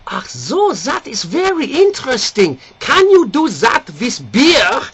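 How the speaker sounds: a stiff curious German; German accent. Retold or imitated. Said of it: "the German gets up".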